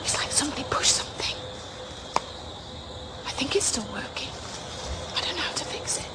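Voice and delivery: quick hushed whisper; nervous whisper in the dark. Real, not acted. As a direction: keep it low and fast, scared. Camera failed, alone in woods.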